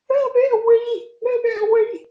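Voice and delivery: very high, squeaky wheezing through laughter. Retold or imitated. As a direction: squeeze the words out high while helpless with laughter. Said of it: "He was, like, wheezing".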